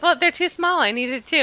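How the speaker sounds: high-pitched comic protest; mock defensive. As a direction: justify yourself with playful indignation. Retells defending her two coffees.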